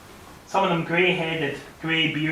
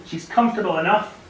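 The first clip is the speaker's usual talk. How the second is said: plain reading voice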